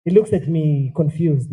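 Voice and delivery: ordinary talk, nothing marked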